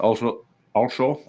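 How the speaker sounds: put-on Also, also with a lisping Scottish accent, lightly done; Sean Connery. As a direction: slip into a Scottish lisp for a moment. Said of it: "I always turn into Sean Connery".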